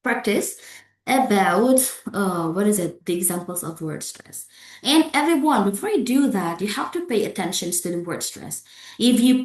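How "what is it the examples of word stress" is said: normal talking voice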